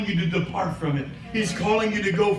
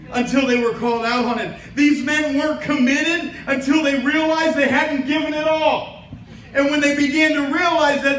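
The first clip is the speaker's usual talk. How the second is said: louder, driving sermon voice; preaching; 4 semitones higher, 3 dB louder than usual. Acted. Pastor preaching to the congregation, rising fervor. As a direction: build the point to the congregation.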